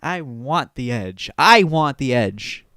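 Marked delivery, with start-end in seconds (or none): 1.4-2.7 imitating, the same words said much louder; louder mock demand. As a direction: push it out hard, mock hungry for edge. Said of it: "who are like".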